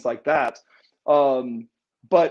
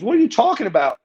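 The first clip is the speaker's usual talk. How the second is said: higher-pitched baffled challenge; mock incredulous question, pitched up; retold disbelief; 7 semitones higher, 4 dB louder, faster than usual. Retold argument over crowd noise.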